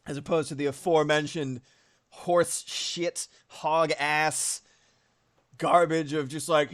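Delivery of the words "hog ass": ordinary talk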